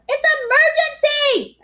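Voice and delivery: put-on high, slow, desperate child's shout. A narrator's reading. Girl shouting at the locked bathroom door, desperate. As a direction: stretch out the plea, urgent and high. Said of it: "I shouted".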